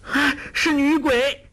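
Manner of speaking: high, slow terrified cry; shriek of fright. A narrator's reading. 黄蓉 hears a woman's voice in the coffin, shrieking. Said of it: "惊叫".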